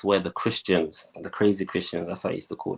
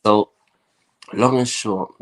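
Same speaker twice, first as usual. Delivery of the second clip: usual speaking voice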